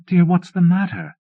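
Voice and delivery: high anxious plea to husband; worried, loud and urgent. A narrator's reading. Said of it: "she asked me anxiously".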